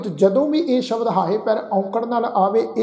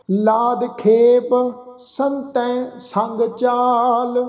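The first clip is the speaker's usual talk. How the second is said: slow, chant-like Gurbani reading; drawn-out verse with pauses between phrases; scripture recitation; 3 semitones higher, much slower than usual. Shown as a demonstration. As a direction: recite slowly, stretching each line end.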